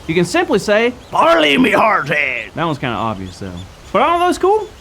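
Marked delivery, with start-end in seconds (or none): 1.1-2.5 slow low pirate voice. Shown as a demonstration. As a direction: drag it out like a pirate captain. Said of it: "to talk like a pirate".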